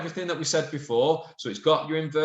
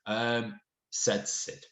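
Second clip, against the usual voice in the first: usual speaking voice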